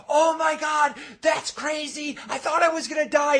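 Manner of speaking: high, loud hammed-up relief; overreacting fanboy yelling. Fanboy competes for video time, overacted yelling. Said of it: "He's, like, yelling".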